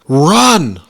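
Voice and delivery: loud, high yelled warning; urgent shout. A narrator's reading. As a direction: shout it as an order to flee.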